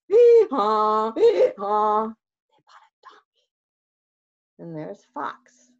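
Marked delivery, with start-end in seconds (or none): 0.1-2.1 loud hee-haw; donkey bray. Acted. The new donkey braying, loud bray. 2.1-3.5 hushed whispered reveal. A narrator's reading. Narrator revealing the donkey to kids, whispered. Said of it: "whispered reveal".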